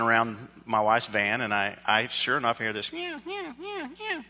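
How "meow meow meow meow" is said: high, thin kitten mews; meowing cat. Retold or imitated. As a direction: mimic a kitten's repeated soft meows. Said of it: "this cat sound".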